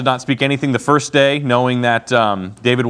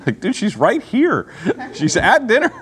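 laughing disbelief; 4 semitones higher, slower than usual. Real, not acted. Laughing at Saul's insult.

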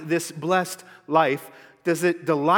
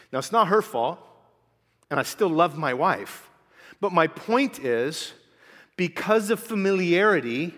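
ordinary delivery

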